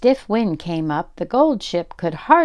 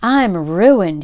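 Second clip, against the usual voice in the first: wailing; 7 dB louder than usual. A narrator's reading. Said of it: "he wailed".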